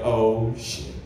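low, sinking mock dismay; chastened, deep-pitched realisation; cowed reaction. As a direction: let it drop low as you back down. Acts himself cowed by wife's retort.

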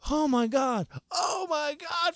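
a touch of a high, slow mock wail; panicking mom. Mom distraught at the party, mildly hammed-up panic. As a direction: wring it out like a distraught mother.

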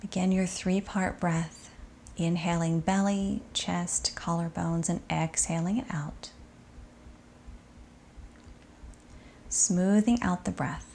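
soft, unhurried body-scan voice with long settling pauses; guided meditation. Acted. Yoga teacher guiding students to sink into shavasana, soft meditation voice.

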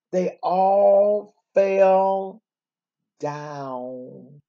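very slow, sinking to a hush on down; slow fall to a murmur; dramatic slowdown. Acted. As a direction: drag it out for suspense. Dramatic story climax.

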